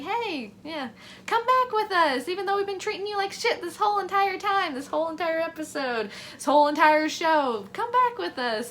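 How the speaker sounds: high, pleading mock invitation; mock hopeful. Squad asking Crosshair to come back, mock hopeful and high.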